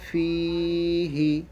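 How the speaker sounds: ফি held long, হি said quickly; long then quick. Shown as a demonstration. Teacher showing which letter is quick, long ফি and short হি. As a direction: stretch the i of ফি, keep হি short. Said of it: "হিটাকে তাড়াতাড়ি উচ্চারণ করতে হবে".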